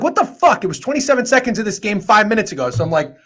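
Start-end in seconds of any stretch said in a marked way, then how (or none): 0.0-0.6 disbelief. Retold or imitated.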